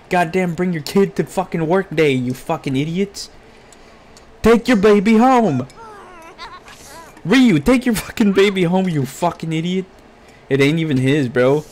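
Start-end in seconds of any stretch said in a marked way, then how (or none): 4.4-8.9 high, loud scolding; exasperated yell. Real, not acted. Gamer scolding Ryu about his baby, loud high yell. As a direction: yell it at the screen. Yelling at game character.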